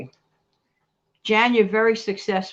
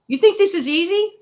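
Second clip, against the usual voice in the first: higher, louder teasing challenge; mock challenge; 9 semitones higher, 5 dB louder than usual. Real, not acted. Host teasing viewers that going online is easy. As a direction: dare them to think it's easy.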